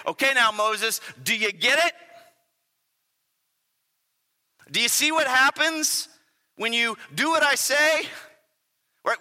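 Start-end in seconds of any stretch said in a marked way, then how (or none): 0.1-8.1 higher, insistent divine voice; voicing God. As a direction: press Moses to understand. Preacher voicing God again.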